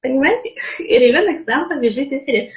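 normal talking voice

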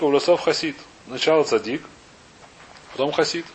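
ordinary talk, nothing marked

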